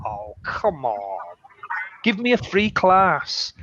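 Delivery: teacher pretending to beg his student; mock begging. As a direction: beg for a free class like a lazy pupil. Teacher pleads for free class.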